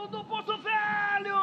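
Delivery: slow, stretched goal yell; drawn-out goal shout. Real, not acted. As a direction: hold the club name long.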